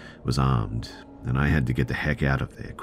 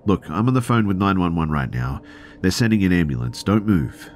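urgent instruction; 6 semitones higher, 4 dB louder than usual. Retold or imitated. Urging injured man to stay.

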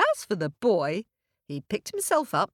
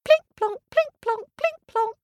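very high plinking steps; tiny footsteps; 5 dB louder than usual. Acted. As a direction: patter it like tiny ant legs.

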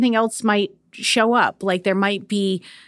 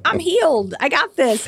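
cocky self-mock; 8 semitones higher than usual. Imitating her own cocky self.

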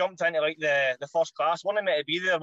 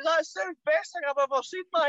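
much higher, amazed and gushing; squeaky, thrilled wee pal; excited teammate; 10 semitones higher than usual. Retold or imitated. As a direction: impersonate a buzzing teammate who can't believe it. Teammate raving about the goal.

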